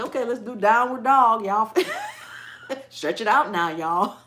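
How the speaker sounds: loud, laughing, down-home yoga call; hyper yoga instructor. Pretend. The yoga teacher she wishes for, loud and hyper. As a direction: belt it out to the class, breaking into laughs. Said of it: "I want somebody to say".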